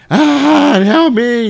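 high, loud mock scream for help. Boy floating away screams to his mom, loud high mock scream. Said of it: "He's screaming".

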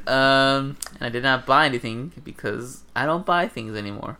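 ordinary talk

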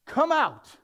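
high, loud summons; Jesus calling Lazarus out, loud and high. Acted. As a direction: call him out of the tomb. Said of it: "called in a loud voice".

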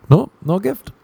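a hint of mom; mom's reply. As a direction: mom tells her son, lightly voiced. Mom answers in the call.

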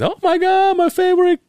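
very high-pitched gushing greeting; delighted grandma. Retold or imitated. Grandma greeting grandson on a call, imitated high delight. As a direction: squeal it like an overjoyed grandmother, imitated. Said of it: "claims to be so happy when she sees me".

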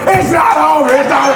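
high, insistent mock shout; outraged comic nerds. Comic nerds insisting to the pastor about the movie, exasperated. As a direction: protest loudly like an exasperated fan.

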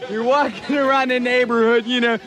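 ordinary talk

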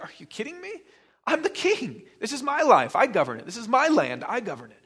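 high, loud mocking king's voice; a haughty king laughing off a peasant; scoffing king. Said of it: "the king would laugh and be like".

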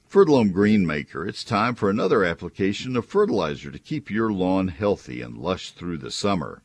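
upbeat radio ad read; chipper 1950s ad. Acted. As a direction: sell it like a cheerful announcer. Live read ad for sponsor.

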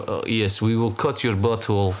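put-on Russian accent, bluntly stated; mock Russian accent. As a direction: Indian accent sliding into Russian. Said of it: "Indian into more of a Mother Russia".

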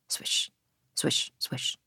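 soft pen scratches, whispered; whispered swish. A narrator's reading. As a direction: breathe the swishes out quietly. Pen sound mimicked softly.

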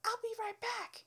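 high, sugary customer-service voice; sing-song fake politeness; fake cheerful. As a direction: put on a bright polite front. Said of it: "And I was like".

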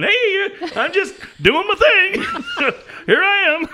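very high, drawn-out mock call; hammed-up calling out. Left out behind two lovers, comic high-pitched calling. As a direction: call out in falsetto for attention. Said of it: "I'm back there with the Hitachis going".

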